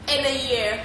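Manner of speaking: ordinary talk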